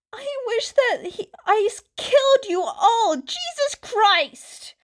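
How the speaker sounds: high, loud half-laughing half-crying shout; hysterical crowd voice. Acted. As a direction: break into a hysterical yell. Crowd member cracking, shouting.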